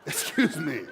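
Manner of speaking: loud, high comic indignation; taken aback. Retold or imitated. Newfoundlander answering a bar insult, taken aback.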